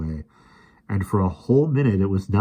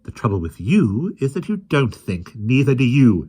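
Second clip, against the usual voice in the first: loud, higher snapping rebuke; snapped; 6 semitones higher, 6 dB louder than usual. A narrator's reading. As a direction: snap at the lion. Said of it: "she snapped at the lion".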